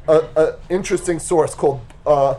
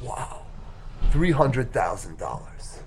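hushed awe; 5 semitones lower, 7 dB quieter, slower than usual. Acted.

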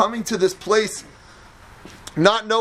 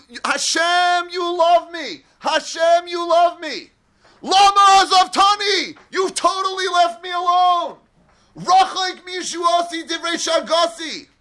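desperate plea; 8 semitones higher than usual. Acted. Esther pleading to Hashem, anguished shouting. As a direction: shout your hurt at God who left you.

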